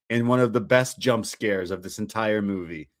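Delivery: ordinary delivery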